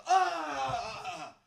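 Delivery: high, thin whine; mock a player writhing on the floor; whiny soccer player. Mocking soccer-loving sons with a high thin whine. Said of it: "they're like".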